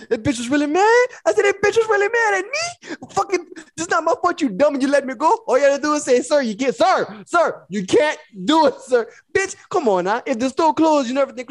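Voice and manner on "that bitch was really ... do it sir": high, indignant rant in the car; mad ranting. Retold or imitated. Alone in the car about the guard: high angry rant. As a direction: rant at her, outraged. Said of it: "I'm mad".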